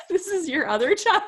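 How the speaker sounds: high, loud and laughing through it; laughing mock question. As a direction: tease a mother, giggling. Joking about accented son.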